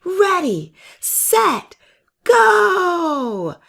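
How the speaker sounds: very high, loud race-starter call; shouted start. Acted. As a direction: call the start for the whole crowd. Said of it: "and then shouted".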